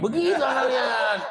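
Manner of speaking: high laughing call at the crowd; loud, laughing jab at the listeners; laughing shout. Real, not acted. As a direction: tease the congregation through a laugh. Preacher teasing audience, laughing.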